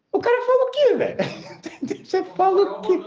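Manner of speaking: can't believe what the guy said; incredulous. Real, not acted. Preacher to the audience, laughing in disbelief.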